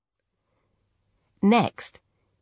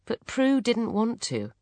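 usual speaking voice

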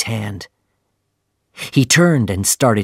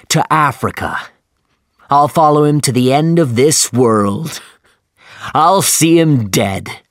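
loud, high furious outburst; angry shout; 4 semitones higher, 4 dB louder than usual. A narrator's reading. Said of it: "shouted angrily at Starbuck".

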